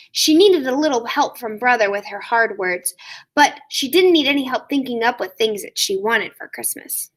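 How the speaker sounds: usual speaking voice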